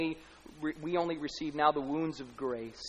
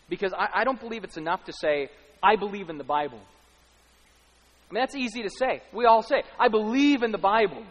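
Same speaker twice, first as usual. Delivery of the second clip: higher, louder preacher's cry; preaching at full force; 6 semitones higher, 9 dB louder than usual. Acted. Preacher challenging the congregation in full force. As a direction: hammer it home to the crowd.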